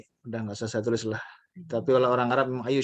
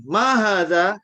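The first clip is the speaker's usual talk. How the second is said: loud, higher question read slowly for repeating; teacher model reading; 5 semitones higher, 6 dB louder, much slower than usual. Shown as a demonstration. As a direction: model the question for the class to echo. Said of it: "saya baca, Bapak Ibu tirukan". (guru membaca contoh pertanyaan dengan keras)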